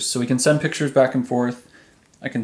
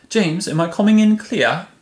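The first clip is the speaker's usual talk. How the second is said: higher-pitched, badly faked British accent; terrible British accent; 7 semitones higher, 4 dB louder than usual. Calling a friend in the UK to check the line, in a fake British accent. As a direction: put on a hammy British accent with a non-rhotic "clear". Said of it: "he understood my British accent there".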